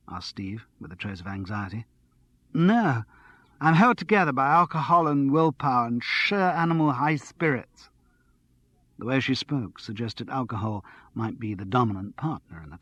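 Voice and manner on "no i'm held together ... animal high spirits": lightly voiced bubbly flatmate, higher; giddy and a little tipsy, pitched up; a hint of a breathless, excited girl; excited tipsy young woman. Andrea answers Steve.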